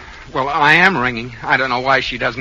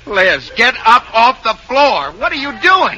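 exasperated alarm; 7 semitones higher, 3 dB louder than usual. Acted. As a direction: demand your wife stop, voice climbing. Husband alarmed at wife's antics.

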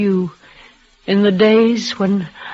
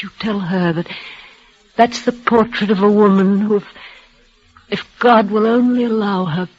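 normal talking voice

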